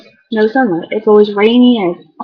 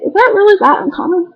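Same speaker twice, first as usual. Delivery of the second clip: incredulous; 8 semitones higher than usual. Retold or imitated. Incredulous reply to classmates.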